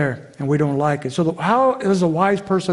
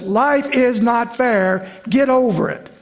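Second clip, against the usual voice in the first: higher, emphatic mock parental retort; acted tired parent laying down the law; imitating fed-up parent; 5 semitones higher, slower than usual. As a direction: shut down the kid's complaint, slow and emphatic at first. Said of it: "you're tired".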